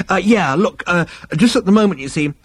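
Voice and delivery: fast, high, hesitant pleading; flustered interruption. A narrator's reading. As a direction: urgently try to get a word in. Zaphod urgently tries to interrupt.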